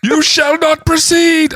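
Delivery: very high, loud, slow proclamation; booming wizard voice. Acted. Wrong Gandalf line.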